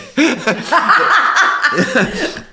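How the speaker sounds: loud, high burst of laughter; big real laugh. Real, not acted. Pundit cracking up at his own Spain story.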